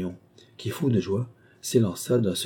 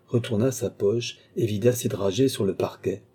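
ordinary delivery

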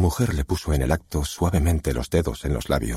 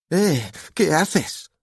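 high, drawn-out daughter's protest; indignant teenager, pitched way up; startled protest; 14 semitones higher, much slower than usual. A narrator's reading. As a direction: protest in a slow, high whine. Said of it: "protestó Dakota".

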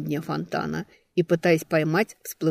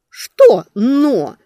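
loud, high indignant snap; indignant outburst; 7 semitones higher, 12 dB louder than usual. A narrator's reading. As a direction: demand it indignantly. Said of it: "возмутилась я из фонтана".

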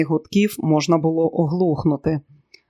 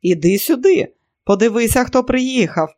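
higher-pitched, lively summons; calling out; 6 semitones higher than usual. A narrator's reading. Woman summons someone from the shop, calling out. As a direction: call her over with a mild shout. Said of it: "закричала жінка комусь у магазинчику".

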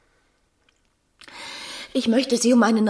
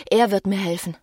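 normal talking voice

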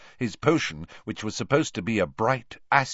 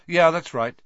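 offhand; 10 semitones higher, 7 dB louder than usual. Acted.

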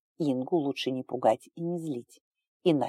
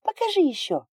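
put-on eager child; 4 dB louder than usual. Little girl demanding.

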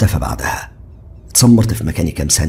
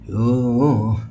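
higher, louder exasperated friend; fed-up Ziad; 10 semitones higher, 8 dB louder than usual. A narrator's reading. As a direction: sigh it out, annoyed at being pestered. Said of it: "هز دماغه بزهق".

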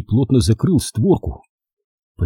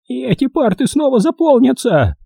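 creaky crow voice; 3 dB louder than usual. A narrator's reading. Old crow prophesying killing to the boys, high and creaky. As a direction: rant like a creaking old witch.

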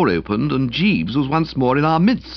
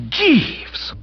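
very high, sharp outcry; appalled exclamation. A narrator's reading. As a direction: cry the name in horror. Appalled narrator rebukes Jeeves.

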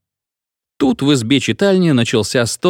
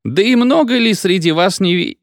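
put-on drunk feldsher, carried away; 8 semitones higher than usual. A narrator's reading.

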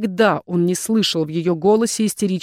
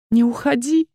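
higher-pitched plea, voiced rather than whispered; pleading; 5 semitones higher than usual. A narrator's reading. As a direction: beg him not to go. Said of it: "прошептала она".

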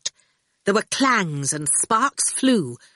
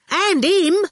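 high, raised, insistent voice; cheeky bargainer; 12 semitones higher, 5 dB louder than usual. A narrator's reading. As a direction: argue the case, pressing questions. Ginger's character voice.

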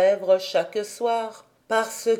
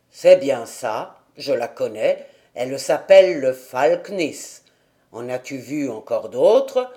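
put-on lower, gruff old man; old grandfather; 7 semitones lower, 4 dB louder than usual. A narrator's reading. Grandfather answers Heidi about the flowers, lower old man's voice.

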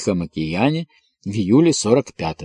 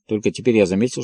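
ordinary delivery, nothing marked

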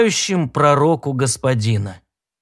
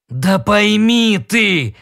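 hoarse, slow, louder exclamation; 5 semitones higher, 3 dB louder, much slower than usual. A narrator's reading. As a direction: rasp it out, drawn out. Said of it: "прохрипел Донован".

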